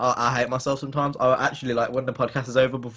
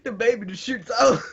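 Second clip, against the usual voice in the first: mock labour; 12 semitones higher than usual. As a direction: wail high like in labour. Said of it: "what I'm like when I'm giving birth".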